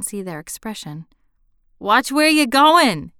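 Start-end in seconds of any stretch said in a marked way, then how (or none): 1.8-3.1 put-on loud, drawn-out Boston accent, pitched high; mock a Bostonian telling someone off, slowly and loudly; facetious Boston accent. A narrator's reading. Young man teasing a stranger he nearly bumped, facetious Boston accent. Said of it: "said facetiously in the famous Boston accent".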